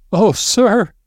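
high wailing moan. A narrator's reading. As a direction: moan in distress. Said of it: "she moaned".

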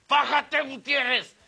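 high, loud mock yell of a prefect; shouting school prefect. Prefect calling out an unruly prep-school student, shouted. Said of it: "ya entendí a mi prefecto de la prepa".

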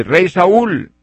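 high, loud call across the distance to the king. Retold or imitated. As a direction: shout it so the king hears from far away. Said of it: "le grita, le dice".

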